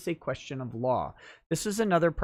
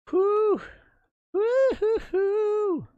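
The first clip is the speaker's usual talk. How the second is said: whooping; 5 dB louder, much slower than usual. Real, not acted. Celebratory whoop on stream.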